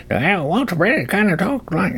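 put-on high-pitched Old West sidekick; hammed-up old western movie codger; Walter Brennan. Host badly imitating an old western actor, high and folksy.

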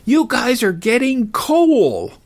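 high, slow yelled threat; mock yell. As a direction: shout it after the departing car. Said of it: "I yelled out as loud as I could".

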